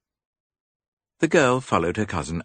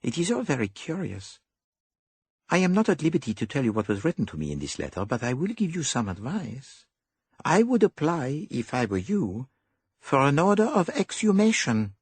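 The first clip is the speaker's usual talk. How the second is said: higher, fussy little detective; higher dapper detective; Poirot voice; 11 semitones higher than usual. A narrator's reading. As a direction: play the clever Belgian.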